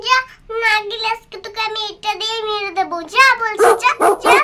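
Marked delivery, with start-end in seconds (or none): 3.1-4.4 loud commanding yell of go away; shouting at dog. Acted. Little sister yelling at the dog to go away, loud.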